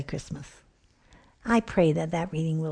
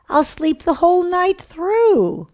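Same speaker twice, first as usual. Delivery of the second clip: much higher and louder, thrilled; cheering mom; 12 semitones higher, 7 dB louder than usual. Acted. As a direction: squeal it with delight. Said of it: "the mom cheered".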